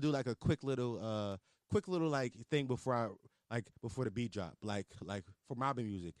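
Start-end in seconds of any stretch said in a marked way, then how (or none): none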